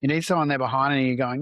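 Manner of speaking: normal talking voice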